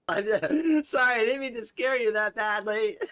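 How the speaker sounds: laughing apology. Real, not acted. Streamer apologizing for scaring a viewer, laughing. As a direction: giggle out the sorry.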